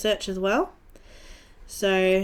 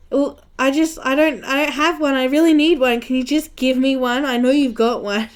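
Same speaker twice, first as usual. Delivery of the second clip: higher, louder, insistent begging; retold frantic plea, raised voice; imitated pleading; 5 semitones higher, 6 dB louder than usual. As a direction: beg the teacher innocently for one. Pleading with teacher for rubber.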